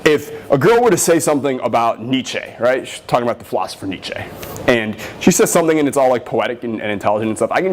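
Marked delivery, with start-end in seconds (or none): none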